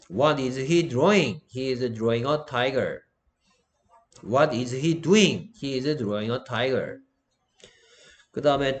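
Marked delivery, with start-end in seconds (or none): 0.1-7.0 very slow question and answer practice; slow drill. Shown as a demonstration. Teacher modelling a question and answer for students, slowly.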